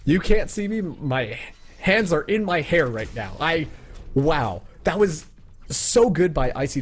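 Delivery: thrilled, high and loud; excited. Real, not acted. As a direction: hype shout at the big play. Caster reacts to clutch play.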